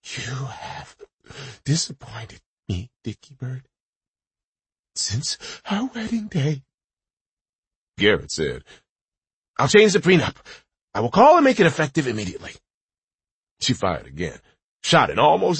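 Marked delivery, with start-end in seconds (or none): 0.0-6.6 choppy, raspy, sickly voice. Acted. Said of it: "choppy, raspy, getting sick voice". 9.6-12.5 panicked bargaining. Acted.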